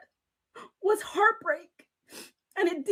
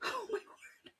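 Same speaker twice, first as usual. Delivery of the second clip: much softer, choked whisper; breathy near-silent words; 5 semitones higher, 16 dB quieter, slower than usual. Real, not acted. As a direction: barely get the words out. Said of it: "I'm gonna cry".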